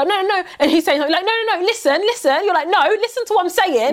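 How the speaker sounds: high, fast heated back and forth; animated retold argument. Friend retells arguing with the dog owner, heated pitch.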